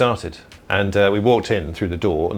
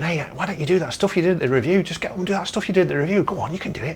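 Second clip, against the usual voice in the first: mate urging; 9 semitones higher, faster than usual. Retold or imitated. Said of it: "my mate's going".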